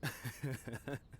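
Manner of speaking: a quick laugh at his own joke; short laugh. Real, not acted. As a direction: laugh it off lightly. Laughs at own pickup line.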